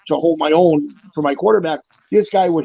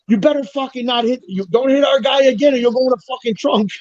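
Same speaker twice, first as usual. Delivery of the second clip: raised, higher mock yell at the big guy; retold yelling; 8 semitones higher than usual. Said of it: "the Italian guys were yelling".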